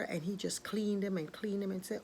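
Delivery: plain reading voice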